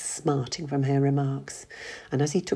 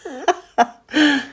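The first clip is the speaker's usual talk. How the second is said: laugh; 12 semitones higher, 8 dB louder than usual. Acted. John laughs at his joke.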